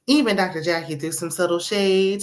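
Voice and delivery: usual speaking voice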